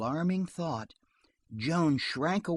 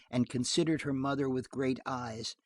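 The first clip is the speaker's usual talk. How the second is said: ordinary delivery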